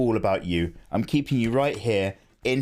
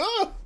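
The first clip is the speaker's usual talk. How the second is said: mock freak-out. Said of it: "I was freaking out".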